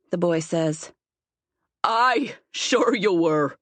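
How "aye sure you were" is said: gruff man scoffing; mocking, rough-voiced reply with a touch of amusement. A narrator's reading. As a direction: draw out a rough, amused jeer.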